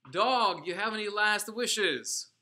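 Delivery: loud, high, contemptuous yell; sneering guard's shout. Retold or imitated. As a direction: jeer at the man with the noose. SS officer taunting before hanging.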